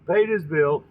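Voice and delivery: plain reading voice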